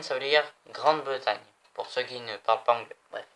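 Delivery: normal talking voice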